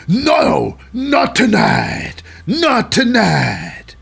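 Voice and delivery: high, slow, stretched-out yelling; mock authority shout. Host as Adam Pearce setting everybody straight, drawn-out high shouting. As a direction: holler it to halt a brawl.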